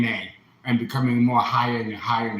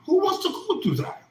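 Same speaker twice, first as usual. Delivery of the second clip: leaps much higher in disbelief; incredulous outburst; faster than usual. Real, not acted. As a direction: fling the question up high. Rhetorical outburst.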